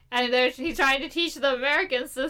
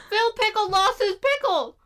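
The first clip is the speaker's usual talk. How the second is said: high, dramatic cartoon-announcer call; Rugrats-style dramatic call; mock cartoon announcer; 9 semitones higher, 4 dB louder than usual. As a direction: announce it like a kids' show alarm.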